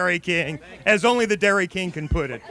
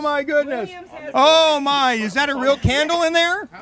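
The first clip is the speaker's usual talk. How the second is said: ordinary delivery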